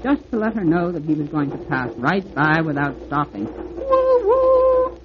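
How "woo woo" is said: official train whistle. Acted. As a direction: toot it proudly like an engine. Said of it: "in his most official voice".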